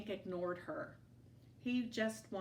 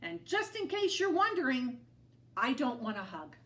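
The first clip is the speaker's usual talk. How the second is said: grumpy; 5 semitones higher, 6 dB louder than usual. A narrator's reading. Hank rude to lizard.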